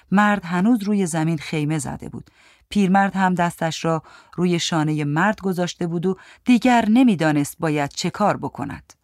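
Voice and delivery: ordinary delivery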